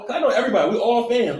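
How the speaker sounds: ordinary talk, nothing marked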